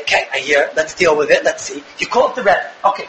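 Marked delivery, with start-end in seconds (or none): 0.0-1.8 much lower, even and reassuring; calm parent. Shown as a demonstration. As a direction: settle the child down, no alarm at all. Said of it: "You say".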